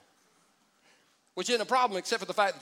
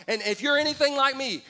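normal talking voice

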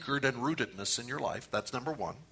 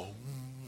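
meditation hum; 14 dB quieter than usual. Shown as a demonstration. Preacher mimics cross-legged meditation for the congregation, soft mock hum. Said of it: "you do this, go".

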